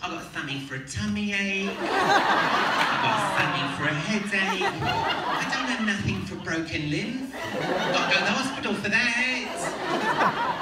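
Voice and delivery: high, slow, increasingly loud nurse; protesting set nurse. Retold or imitated. Nurse listing her bag's contents to the actor, high and loud. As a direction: drag out each item in a shrieky high voice. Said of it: "I really wanna hear the nurse".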